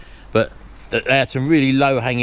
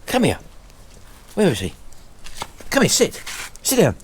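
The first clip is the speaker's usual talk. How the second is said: dog command; 6 semitones higher than usual. Real, not acted.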